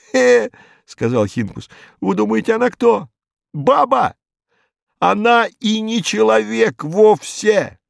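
a panicky thug trying to make them understand; agitated Hinkus. A narrator's reading. Hinkus scoffing at the inspector, high and frantic.

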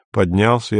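plain reading voice

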